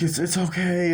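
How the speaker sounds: high-pitched retold comforting; reassuring. Someone comforting a dying woman, high mock reassurance. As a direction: imitate gentle reassurance, voice lifted.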